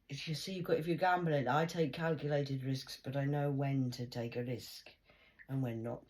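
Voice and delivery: loud, high, insistent lecture; emphatic self-quote. Retold or imitated. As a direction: hammer home the gambling rule, slowing on the risks. Quoting her own firm rule.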